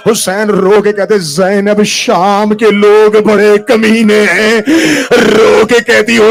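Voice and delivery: loud, slow chanted wail; full-force lament. Acted. Orator chanting Zainab's plea to the mourners. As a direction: wail it out like a dirge.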